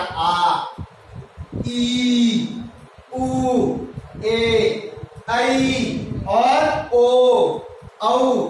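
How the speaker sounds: आ, ई, उ, ए, ऐ, ओ, औ each held long and slow; long vowels demo. Shown as a demonstration. Teacher showing pupils how long vowels are held, each drawn out slowly. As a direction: stretch every vowel to twice its short length. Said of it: "देखिए समय क्या आ रहा है? बढ़ रहा है".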